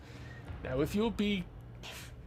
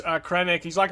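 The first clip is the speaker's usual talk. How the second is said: normal talking voice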